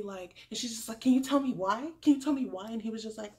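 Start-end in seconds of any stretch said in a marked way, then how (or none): 1.0-2.7 louder, higher retold pleading question; imitated demand. Rejected woman questioning the lead, imitated demand.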